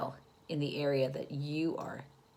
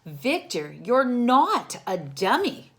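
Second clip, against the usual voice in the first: reassuring mom; 6 semitones higher, 7 dB louder, slower than usual. Acted. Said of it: "said Mom".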